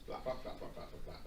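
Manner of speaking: whispered flap flap flap; soft breathy wing flaps; wing flapping noise. Pretend. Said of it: "You gotta make the flapping noise".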